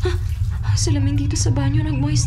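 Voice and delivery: high, loud, stuttering terror; terrified. Acted. Impostor seeing writing on the bathroom mirror, terrified. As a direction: panic rising with each discovery.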